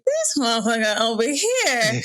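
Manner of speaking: laughing through the line. Retold or imitated. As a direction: chuckle while saying it. Laughing at the memory.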